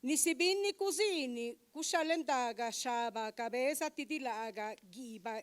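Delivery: measured, rhythmic poetry reading; poem recital. Acted. Poet reading her Zapotec poem aloud to the audience, measured recital. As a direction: recite each line with care and space.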